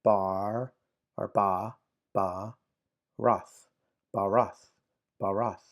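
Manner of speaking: "ba, roth" sounded out piece by piece; "ba, roth" broken up slowly for beginners; syllables separated. Shown as a demonstration. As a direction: say "ba", then "roth", each on its own. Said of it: "treat each syllable separately".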